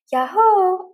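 high, loud joyful whoop; cowgirl whoop. Acted. Jessie arriving to the rescue, whooping. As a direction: whoop as you ride in. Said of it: "a voice cried".

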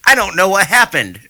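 lightly voiced confused defendant; clueless Philip Halsman. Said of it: "an idiot who was like".

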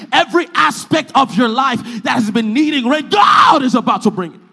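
loud proclamation climbing to a high shout; full-force preaching. Real, not acted. Preacher promising rain to congregation, building full-force shouted preaching. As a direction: hammer each promise harder, building to a shout.

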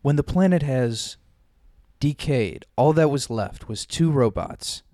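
dramatic voiceover, slow at end; movie trailer narrator. Acted. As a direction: announce the epic tale.

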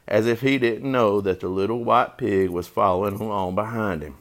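ordinary delivery, nothing marked

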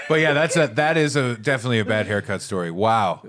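ordinary talk, nothing marked

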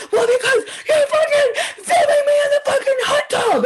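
high shrieking rant, near tears; mock furious shout. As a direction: scream it at the cameraman. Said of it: "She's like".

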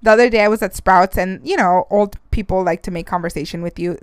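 ordinary talk

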